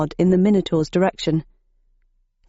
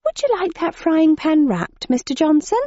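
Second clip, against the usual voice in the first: higher, polite shop assistant; shop lady voice; 9 semitones higher than usual. A narrator's reading. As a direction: serve the customer sweetly. Asked the lady serving.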